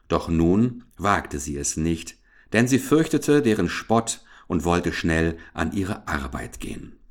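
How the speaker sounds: plain reading voice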